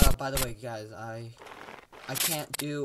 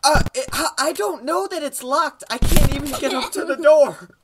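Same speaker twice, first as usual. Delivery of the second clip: frustrated; 9 semitones higher, 10 dB louder than usual. Real, not acted. Frustrated at game.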